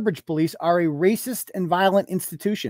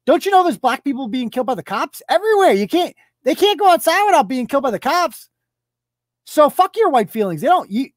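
higher, mocking whiny voice parodying the group; mocking activist voice; 6 semitones higher than usual. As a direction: sneer it out like a preachy ally lecturing you.

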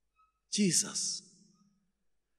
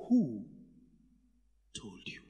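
hushed voice of God; 10 dB quieter than usual. Acted. God asking Adam in the garden, hushed. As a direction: drop to a near-silent, much softer question.

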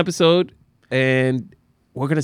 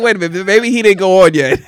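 surprised; 5 semitones higher, 4 dB louder than usual. Retold or imitated. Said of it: "I was like".

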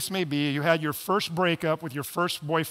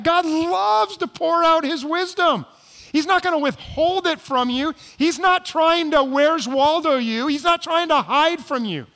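louder, higher, building sermon push; preaching at full force; 8 semitones higher, 5 dB louder than usual. Acted. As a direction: drive it home to the congregation.

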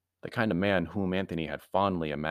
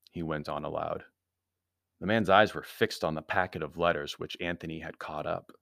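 plain reading voice

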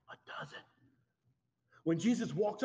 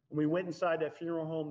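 ordinary talk, nothing marked

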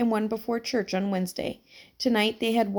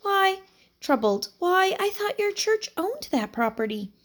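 put-on troubled old lady; 10 semitones higher than usual. A narrator's reading. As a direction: puzzle over it gently. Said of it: "Then she looked troubled".

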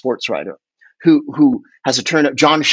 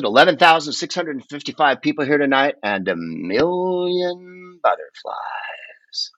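slowing to a whisper on butterflies; hushed announcer awe; 2 semitones higher than usual. Retold or imitated. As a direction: let the last word drift away in a hush. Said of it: "What does he say".

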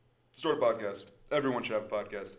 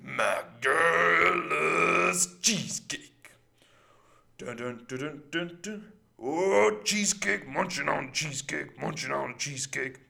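raspy jazz singer growl, sung slow; slower than usual. Pretend. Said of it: "I'll do it in the [name] voice".

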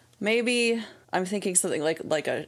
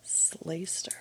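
unvoiced, tentative whisper; 12 dB quieter than usual. Real, not acted. Player puzzling over a Scots word, whispering it. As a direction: whisper it to yourself, testing the word.

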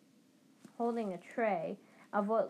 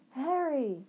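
panting; 5 semitones higher, 5 dB louder than usual. Acted. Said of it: "Mister Weasley panted".

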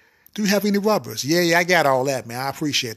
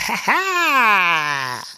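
a big, much higher burst of laughter; loud laugh; 8 semitones higher, 4 dB louder than usual. Real, not acted. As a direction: laugh out loud at your own joke. Comedian laughs at own line.

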